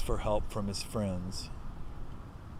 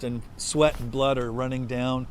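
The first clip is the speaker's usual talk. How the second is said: ordinary delivery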